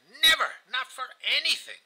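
high, emphatic outburst; vehement regret. A narrator's reading. Widower swearing he would never have married her, vehement. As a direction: jump up in pitch and force.

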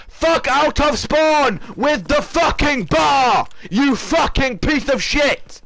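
high, drawn-out yelling; slow, high-pitched bellow of real anger; furious shout. Real, not acted. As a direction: rage at them, stretching every word. Raging at enemy in spawn.

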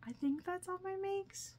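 high singsong closing line; sing-song sign-off. Real, not acted. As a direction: float it up like a playful wrap-up. Sing-song wrap-up of the haul.

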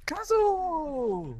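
long high call of the name. Real, not acted. Calling out.

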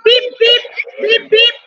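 playful horn beep-beep; car horn beeps. Shown as a demonstration. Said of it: "What is the sound of the horn".

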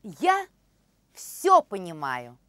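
normal talking voice

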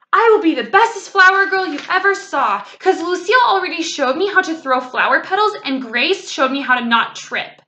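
put-on high, loud gushing child; excited little-girl boast, starting at a shout. A narrator's reading. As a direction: rattle on proudly to your aunt. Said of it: "very excited".

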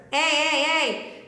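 high twangy pirate call; narrow, twangy "hey". Shown as a demonstration. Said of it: "for like a pirate".

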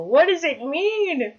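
high, loud, drawn-out wonder; double rainbow impression. Player quoting the double rainbow man, awestruck wail. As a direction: wail it slowly in overwhelmed awe. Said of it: "It's like a double rainbow".

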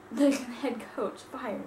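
usual speaking voice

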